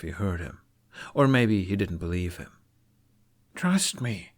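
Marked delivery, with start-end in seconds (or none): 3.5-4.3 pleading. Acted. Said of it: "he pleaded".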